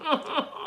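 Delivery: high, soft giggle; chuckle. Real, not acted. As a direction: giggle quietly at your own boast. Chuckles after calling self extraordinary.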